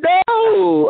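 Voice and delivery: very high, drawn-out whiny refusal; mock wailing protest. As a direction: wail it in exaggerated dread. Recalls dreading wife's eager questions.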